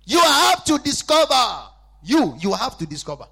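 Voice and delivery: loud, slow, high preaching cry; loud pulpit cry, drawn out; preaching shout. Acted. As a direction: proclaim it at full force. Preaching at full force.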